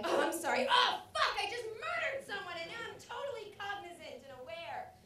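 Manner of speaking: high, loud burst of acted horror, then easing in volume; panicked realization. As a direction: snap to in horror at yourself. Acts snapping back after a murder.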